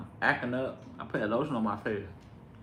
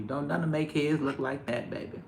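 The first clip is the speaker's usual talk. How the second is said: normal talking voice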